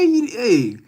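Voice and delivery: usual speaking voice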